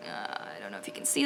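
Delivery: unvoiced muttered aside to the camera; whispered aside. Real, not acted. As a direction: whisper it while fiddling with the thread. Showing thread up close.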